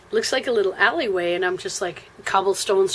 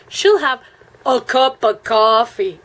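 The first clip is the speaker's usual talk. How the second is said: exaggerated American accent, high, loud and drawn out; mocking American accent; 3 semitones higher, 6 dB louder, slower than usual. Irish server relays her order to a colleague, mocking American accent. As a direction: mimic someone hamming up an American accent. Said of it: "Is that what the American accent sounds like".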